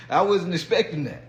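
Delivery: usual speaking voice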